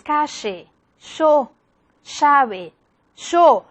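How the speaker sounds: "calle" said "cashe", "yo" said "sho"; Buenos Aires sheísmo. Shown as a demonstration. Teacher demonstrates Buenos Aires sheísmo to students. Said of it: "La región de Buenos Aires".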